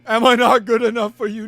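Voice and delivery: hammed-up wounded demand; mock indignant shout. Mock plea to absent narrator.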